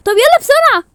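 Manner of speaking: high, loud urgent call; alarmed, loud and high; urgent rallying. Acted. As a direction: rush them along at full voice. Urgent rallying after bad news.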